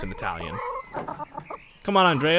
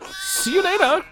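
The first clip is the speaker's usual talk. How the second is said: "See you later!" sung much higher, stretched and bouncy; sing-song goodbye; 4 dB louder, slower than usual. Pretend. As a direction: call "See you later!" like a cheery parody. Exaggerated sing-song farewell.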